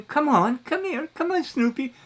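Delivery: high coaxing call to a pet; coaxing a dog. Retold or imitated. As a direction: sweet-talk the dog to come over. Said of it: "I lean over and I'm saying".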